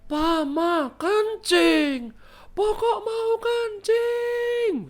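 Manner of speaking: put-on very high-pitched, drawn-out child's yell. Little Fiona tells her parents she must pee, yelling. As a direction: holler it like a toddler the whole village can hear. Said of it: "teriak-teriak, sampai sekampung denger semua".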